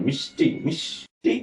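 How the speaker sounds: মিষ্টি said as "mish-ti"; natural sh pronunciation. Shown as a demonstration. Teacher modelling মিষ্টি for students with the sh sound. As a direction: split মিষ্টি after a clear sh. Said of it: "স্বাভাবিক তালিব্য স এর মতো".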